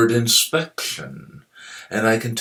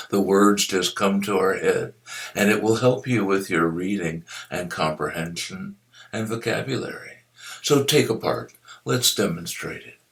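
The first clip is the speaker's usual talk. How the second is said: ordinary talk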